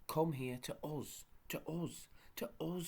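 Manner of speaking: slow, pleading shout, rising; despairing call. A narrator's reading. Joe shouts in despair to the rolling saucepan man. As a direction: call him back desperately. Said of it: "shouted Joe in despair".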